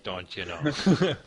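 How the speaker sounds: slow mock Yooper accent; Yooper impression. Game master imitating Yoopers for the player, mock accent. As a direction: stretch it out like a hammed-up Yooper. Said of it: "the Yoopers who are like".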